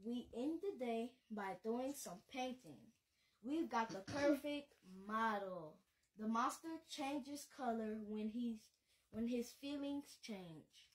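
put-on storybook voice for kids; bedtime-story narration; reading to children. A narrator's reading. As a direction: read it like a picture book to little ones. Reads picture book aloud.

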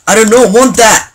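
high, loud mock outrage; indignant refusal. Refusing an unwanted golden helmet.

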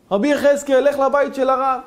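loud, high call from a window; shouting. Acted. Neighbour at the upstairs window shouting down to the rabbi. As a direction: yell it down to him from upstairs. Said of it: "צועק לו מהחלון".

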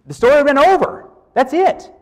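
emphatic peak. Real, not acted. Preacher's emphatic climax.

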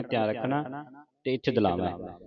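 ordinary talk

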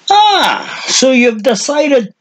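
put-on very high, slow sarcastic old voice; cranky old man. A narrator's reading. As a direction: sneer it like a crazy old hermit. Old man addressing arrivals.

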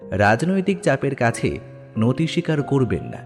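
plain reading voice

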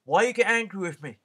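Paul turns the sellers' guilt trick on a waiter; Paul mimicking the sellers. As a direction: throw their own line back at them. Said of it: "And he'd say".

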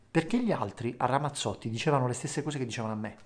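ordinary talk

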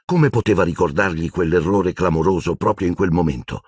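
ordinary delivery

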